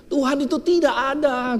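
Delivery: high dismissive scoff in another voice; scoffing atheist. Retold or imitated. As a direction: wave faith away with scorn. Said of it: "katanya, segala macam". (suara ateis yang mencemooh)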